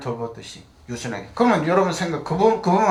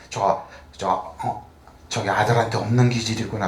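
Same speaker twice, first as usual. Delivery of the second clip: tender musing; 6 semitones lower, 4 dB quieter than usual. Retold or imitated. Grandfather musing over grandson.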